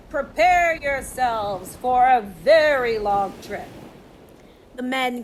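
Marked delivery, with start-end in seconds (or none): 0.1-3.7 loud, slow royal command; king's yell. A narrator's reading. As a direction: call the men to the ships, slowly. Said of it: "The king yelled to the men".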